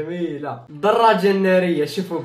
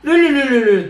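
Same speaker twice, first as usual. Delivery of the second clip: loud, high cry of no; panicked no; 7 semitones higher, 8 dB louder than usual. Real, not acted. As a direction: shout no in alarm. Caught by a ghost. (صراخ لا بذعر)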